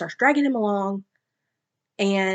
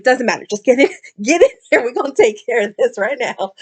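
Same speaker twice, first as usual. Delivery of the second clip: louder, fast, bossy and giggly; eager, laughing command; 4 semitones higher, 7 dB louder than usual. Retold or imitated. Kira pulling her mate in, loud eager laughing order. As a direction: hurry him inside with a laugh. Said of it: "She's like".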